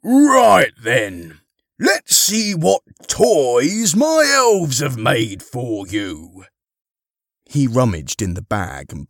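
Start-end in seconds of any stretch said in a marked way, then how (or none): 0.0-6.5 loud, hearty, higher voice; Father Christmas. Acted. Harry as Santa questions the children, booming. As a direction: boom it out to a roomful of children.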